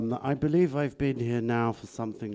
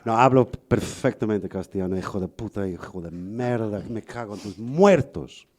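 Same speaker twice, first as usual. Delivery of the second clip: put-on quicker, fluent Castilian Spanish accent; fluent Spanish cursing; 3 semitones lower than usual. Guest proves he can insult in Spanish, fluent Castilian accent. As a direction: swear like a local in Castilian Spanish.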